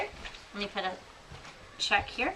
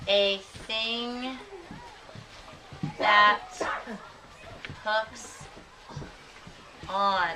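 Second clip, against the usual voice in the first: slow dictation; much slower than usual. Shown as a demonstration. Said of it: "teacher dictates while writing".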